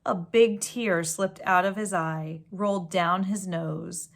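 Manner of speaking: gentle storybook read-aloud; story reading. A narrator's reading. Reading a picture book to children, storybook voice. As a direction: read it like a bedtime story to kids.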